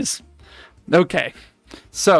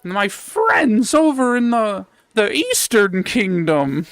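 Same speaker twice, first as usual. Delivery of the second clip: put-on old crone voice; 5 semitones higher, slower than usual. Witch voiced, pitch high.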